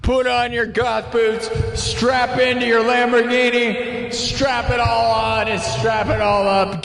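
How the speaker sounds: high, slow, shouted hype chant. Acted. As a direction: pump the crowd up, way high. Hype intro before music.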